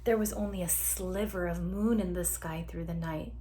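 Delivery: ordinary delivery, nothing marked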